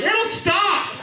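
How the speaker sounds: high, strained holler to another room; hammed-up dismissive yell; yelling back. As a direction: shout it back to get rid of her. Husband yells back to wife.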